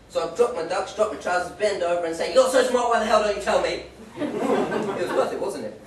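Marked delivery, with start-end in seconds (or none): 2.3-3.8 loud cheeky taunt. Retold or imitated. Mooning punchline.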